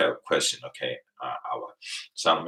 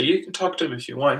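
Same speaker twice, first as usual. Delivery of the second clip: high quick mock offer; 8 semitones higher, faster than usual. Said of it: "I say".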